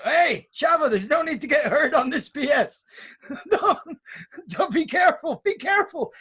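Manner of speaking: high-pitched warning shout, half laughing; mock yelling. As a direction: imitate a worried wrestler calling up to his friend. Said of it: "Gallo starts yelling out".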